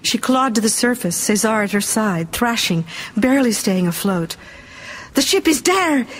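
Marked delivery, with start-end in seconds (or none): 5.1-6.1 high, excited call; mild shout. A narrator's reading. Rescuer points out the ship while swimming, mild shout. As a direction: shout it with raised pitch, little extra volume. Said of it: "he shouted".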